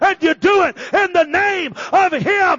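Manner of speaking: loud, driving preacher's delivery; full-force preaching. Real, not acted.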